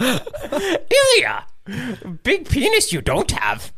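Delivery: high, slow strained Yoda voice; Yoda impression. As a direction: squeeze it out like a tiny Jedi master. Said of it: "Can you do a Yoda impression?".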